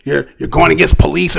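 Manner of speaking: low, hesitant grumble of a critic; imitated objector. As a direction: mimic a naysayer, pitched well down. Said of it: "some people say".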